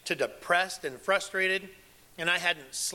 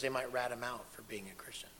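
hushed whisper; 3 semitones lower, 8 dB quieter than usual. Real, not acted. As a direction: whisper it like a secret. Warning of betrayal, hushed.

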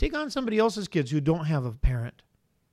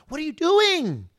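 high, drawn-out exasperated mock demand; nagging parent; 10 semitones higher, 3 dB louder, slower than usual. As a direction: imitate an irritating parent scolding. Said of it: "wanna wring the parent's neck".

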